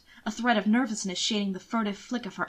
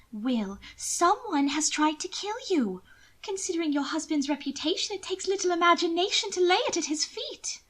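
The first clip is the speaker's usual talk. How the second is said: a worried sister pleading; higher lady's urgent warning; 8 semitones higher than usual. Acted. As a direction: press the danger on him.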